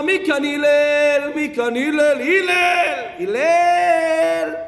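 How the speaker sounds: loud, high, drawn-out calling; mock shouting. Pest returns and hollers for Hillel, mock shouting.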